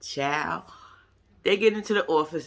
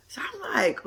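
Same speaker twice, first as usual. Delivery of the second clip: whispered; 7 semitones higher than usual. Real, not acted. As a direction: whisper the lead-in. Whispered lead-in.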